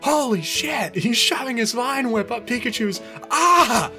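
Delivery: very high horrified exclamation; hammed-up shriek of alarm; shout. A narrator's reading. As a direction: shout in panic, building to a loud cry. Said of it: "Ash shouted".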